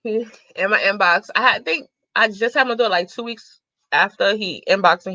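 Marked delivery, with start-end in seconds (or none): none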